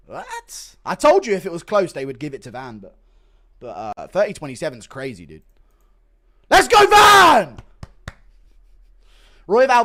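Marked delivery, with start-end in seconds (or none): 0.1-0.8 high squeaked disbelief; shocked what. Real, not acted. Shocked at the scorecard. 6.5-7.6 loud slow rally cry; cheering. Real, not acted. Cheering for the winner.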